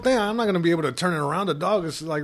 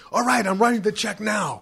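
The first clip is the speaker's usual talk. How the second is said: hurried shout; 6 semitones higher than usual. Retold or imitated. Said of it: "He's like".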